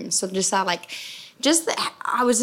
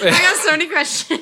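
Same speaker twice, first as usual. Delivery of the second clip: high-pitched laughing outburst; giggly, high exclamation; 10 semitones higher, 4 dB louder than usual. Real, not acted. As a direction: say it through a laugh, pitched well up. Repeats it while laughing.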